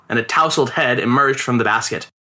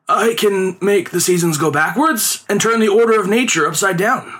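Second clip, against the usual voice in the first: high, declaimed showman's boast; theatrical conjuror's claim, pitched high; boastful performer; 7 semitones higher than usual. A narrator's reading. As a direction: proclaim your magic powers in a high voice. Performer boasts of his powers.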